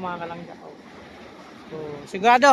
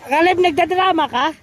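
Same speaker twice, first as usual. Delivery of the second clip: high, loud playful teasing; 8 semitones higher, 7 dB louder than usual. Real, not acted. Teasing the sulking child.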